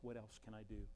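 soft, low surrender; quietness. Acted. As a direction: ask it in a hushed, humble voice. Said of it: "It was just that quietness".

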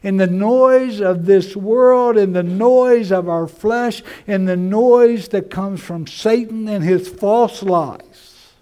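loud, high, slow preacher's swell; full-force preaching. Real, not acted. Preacher naming the noises that drown the shepherd, full force. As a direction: ring out each phrase over the din.

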